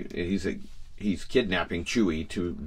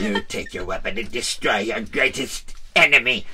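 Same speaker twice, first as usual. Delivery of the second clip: put-on sinister old ruler giving orders; weighty commanding emperor; 3 semitones higher, 5 dB louder than usual. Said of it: "so I'm gonna use the Emperor".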